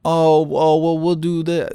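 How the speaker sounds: high-pitched mock of a pleading seller; mocking imitation. Mocks the seller's offer.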